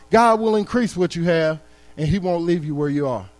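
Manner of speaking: ordinary delivery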